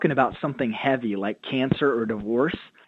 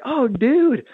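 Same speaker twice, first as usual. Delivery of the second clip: much higher, bright eager hello; excited greeting; 11 semitones higher, 6 dB louder than usual. Retold or imitated. Pastor retelling how he greeted an eager churchgoer, excited and high. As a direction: greet him like you're thrilled to meet him.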